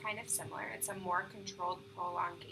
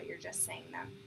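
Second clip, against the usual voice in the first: ordinary delivery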